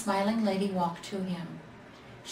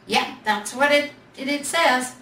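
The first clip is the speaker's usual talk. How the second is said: louder, demanding bear; 5 semitones higher, 6 dB louder than usual. A narrator's reading. Big Bear demands to hear the tag, loud. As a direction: snap out the demand. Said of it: "Big Bear demanded".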